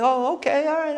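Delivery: very high, placating reply; mock meek acceptance; taken-aback cop. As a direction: back off in a high-pitched imitated voice.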